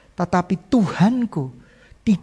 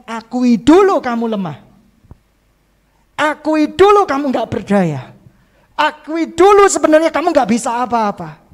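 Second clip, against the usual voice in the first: louder, higher urgent exhortation; preaching at full force; 6 semitones higher, 6 dB louder than usual. Real, not acted. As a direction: drive the call to confess home. (berkhotbah dengan suara lantang)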